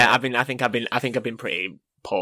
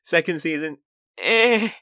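mock review; 6 semitones higher, 4 dB louder, slower than usual. Mock one-word review.

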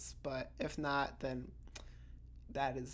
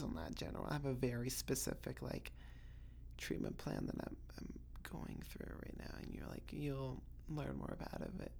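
whisper; 5 semitones lower, 16 dB quieter than usual. Real, not acted. Hushing about her private treatment.

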